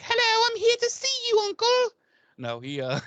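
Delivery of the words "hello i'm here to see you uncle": put-on very high, loud girly voice; girly Angel. Angel in frilly pink dress greeting uncle, very high girly voice. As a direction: sing it out like a little girl. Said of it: "he's like".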